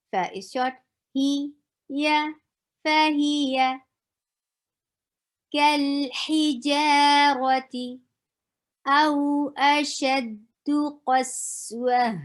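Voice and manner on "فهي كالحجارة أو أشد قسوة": melodic, higher chanted recitation; Quran recitation. Shown as a demonstration. Recites the verse.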